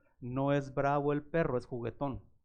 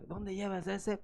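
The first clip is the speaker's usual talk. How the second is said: mocking taunt; 5 semitones higher, 4 dB quieter than usual. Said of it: "y luego decía".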